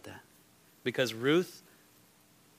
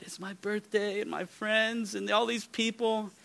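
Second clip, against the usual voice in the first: put-on higher, tearful little-girl voice; imitating a crying girl; 10 semitones higher than usual. Pastor retells the girl crying at camp, high tearful voice. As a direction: play the sobbing child, high.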